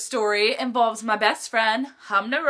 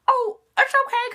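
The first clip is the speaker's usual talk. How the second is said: teacher's reply; 12 semitones higher, 4 dB louder than usual. Retold or imitated. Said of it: "he was like".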